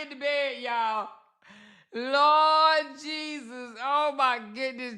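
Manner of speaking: choking on laughter as you describe it; laughing while talking. Real, not acted. Laughing at the photo.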